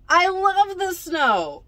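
high, loud and drawn out; slow, exuberant storybook cry of delight; gushing exclamation. A narrator's reading. Character's exclamation in a read-aloud story.

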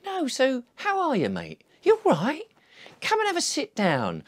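high, bright, friendly invitation; a friendly stranger welcoming a weary official; cheery welcome. Acted. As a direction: warmly insist they sit. Acted chat with an official.

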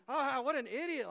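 scornful mock. Mocking opponents in politics.

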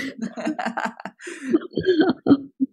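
a big real laugh; hearty laugh. Real, not acted. Laughing at own joke.

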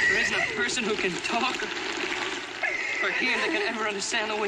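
overjoyed teacher shouting with pride; joyful shouting. Real, not acted.

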